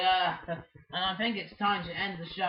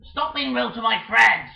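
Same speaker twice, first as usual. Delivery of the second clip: loud, higher telling-off; loud scolding; 4 semitones higher, 11 dB louder than usual. Acted. Defending friends from a rude bird, a loud scolding. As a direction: yell it to stop the bully.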